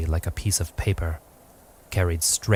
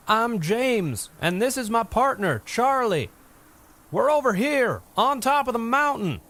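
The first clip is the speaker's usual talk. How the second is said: shouting. A narrator's reading. James introducing himself to the giant by shouting. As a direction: call out to be heard far off.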